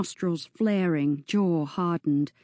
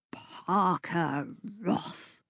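spat out; 6 dB quieter, much slower than usual. Acted. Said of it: "spat out the name".

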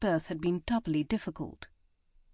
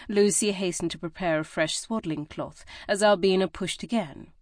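usual speaking voice

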